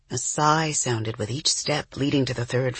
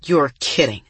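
flat. A narrator's reading. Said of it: "Oliver said flatly".

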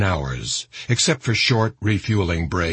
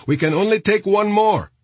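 high, raised yell from inside; 12 semitones higher, 4 dB louder than usual. A narrator's reading. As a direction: call it out urgently, pitched way up. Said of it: "a voice yelled from inside".

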